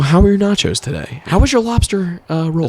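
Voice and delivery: high, put-on customer-service chirp; mock server. Seasonal worker checking on rich diners, mock polite.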